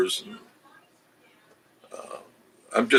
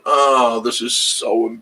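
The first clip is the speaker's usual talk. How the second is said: put-on voice of gawking passers-by, slow and drawn out; a slow, hammed-up mock embarrassment with a laugh; mock embarrassed bystander; 2 semitones higher than usual. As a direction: play a scandalised onlooker, lightly. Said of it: "people are looking and going".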